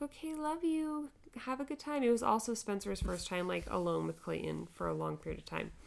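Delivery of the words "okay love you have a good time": cheery goodbye. Retold or imitated. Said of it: "I was like".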